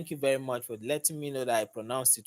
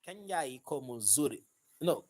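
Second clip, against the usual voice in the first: ordinary talk, nothing marked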